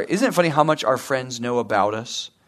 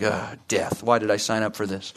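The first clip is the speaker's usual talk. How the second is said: a lower, much softer mock groan of regret; mock despair; 8 semitones lower, 7 dB quieter than usual. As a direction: grumble it to yourself like a worn-out runner. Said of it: "And you're like".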